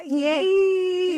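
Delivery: high, drawn-out excited yay; a long, high squeal of excitement; excited cheer. Real, not acted. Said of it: "I'm also excited to be here".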